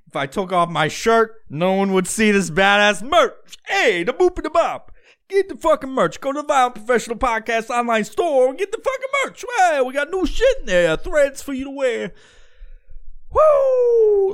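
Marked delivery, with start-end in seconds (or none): 3.7-12.1 high-pitched cartoon hawker; hammed-up merch pitch. Host selling podcast merch, high hammed-up pitch. 13.3-14.3 long high, loud "woo"; whoop. Real, not acted. Host drinking beer after merch plug, loud whoop.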